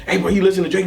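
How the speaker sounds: normal talking voice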